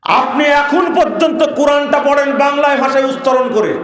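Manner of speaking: loud, high scolding throughout; angry rebuke. Real, not acted. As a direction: berate them at full voice. Rebukes reading Quran in Bengali script. (চড়া গলায় তিরস্কার)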